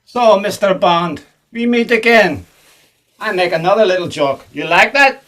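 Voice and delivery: put-on hammy Russian villain accent; thick put-on Russian accent; fake Russian accent. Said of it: "You're not a Russian".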